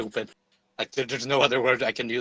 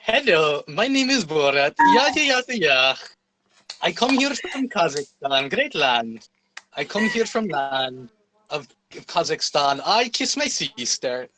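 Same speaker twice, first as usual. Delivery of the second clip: exaggerated mock-Kazakh accent, slow and lilting; hammed-up Kazakh accent impression; Borat; 3 semitones higher than usual. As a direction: put on a comic, broken-English Kazakh accent. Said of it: "asking you to do the Borat voice".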